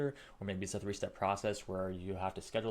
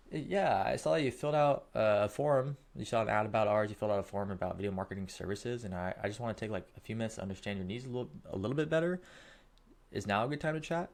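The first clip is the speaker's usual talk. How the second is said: friendly rep checking if now is a good time; upbeat sales pitch; 4 dB louder than usual. Shown as a demonstration. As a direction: pitch the call with curious upward lilt. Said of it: "So let's just role play this".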